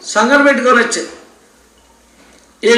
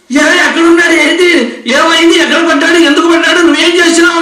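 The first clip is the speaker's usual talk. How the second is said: higher and louder, panicked questions fired at his wife; frantic shout; 6 semitones higher, 4 dB louder than usual. Retold or imitated. Husband panicking at his wife about their child, shouting. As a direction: yell in alarm about the fallen child. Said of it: "అరుస్తున్నాడు".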